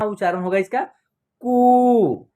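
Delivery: कु stretched into a long oo, slow; slow teacher demo of long कु. Shown as a demonstration. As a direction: draw out the vowel of कु. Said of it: "जिस तरह आप बड़ी ई की मात्रा का उच्चारण कर रहे थे खींच करके".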